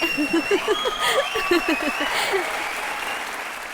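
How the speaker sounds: long, high laugh tailing away; laughter. Real, not acted. Guest amused by her own anecdote, long high laugh. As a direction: laugh high, letting it fade.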